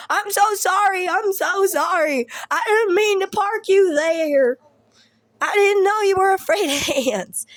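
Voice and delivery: high, loud, hammed-up pleading; over-the-top imitation of a remorseful stepdad; mock frantic apology. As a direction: gush the apology in an exaggerated high voice.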